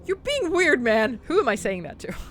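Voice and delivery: usual speaking voice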